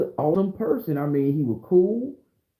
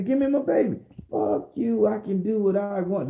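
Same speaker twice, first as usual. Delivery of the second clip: high-pitched acted courtroom outburst; a touch of angry pleading, pitched up; mock defiance; 6 semitones higher than usual. Father demanding his child from the judge, mildly acted defiance.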